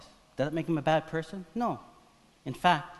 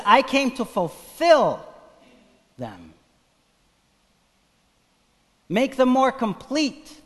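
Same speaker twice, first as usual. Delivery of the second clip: slow emphatic preaching; 10 semitones higher, 8 dB louder, slower than usual. Acted. Preaching Jesus' words.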